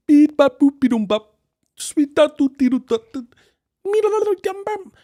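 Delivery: high beeping alien gibberish; alien babble. Retold or imitated. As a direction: chirp nonsense like an alien. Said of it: "talking like an alien".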